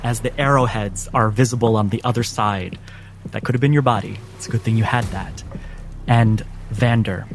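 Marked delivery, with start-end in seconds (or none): none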